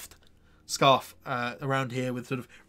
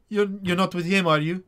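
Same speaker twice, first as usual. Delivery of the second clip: higher, louder, anxious question; slightly wavering voice; 7 semitones higher, 7 dB louder than usual. Acted. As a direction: let the voice waver slightly as you check warily. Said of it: "you hear her voice waver slightly".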